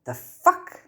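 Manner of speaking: high, stunned disbelief; shocked. Acted. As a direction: blurt it out in shock. Harry shocked by the law.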